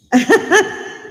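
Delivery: high incredulous burst of laughter; bitter laugh. Real, not acted. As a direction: laugh at the absurdity. Laugh inside mock scene.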